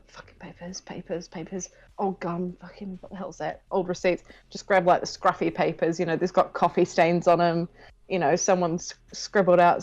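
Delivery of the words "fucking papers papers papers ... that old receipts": muttering to himself. Acted. Rummaging muttering to self.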